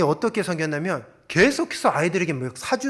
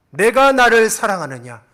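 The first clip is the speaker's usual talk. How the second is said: louder and higher, 내가 stressed, falling at the end; pressing louder; 4 semitones higher, 8 dB louder than usual. Acted. Jesus presses Peter again, louder and higher. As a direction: raise the voice and press 내가 harder. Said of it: "세 번째 이르되".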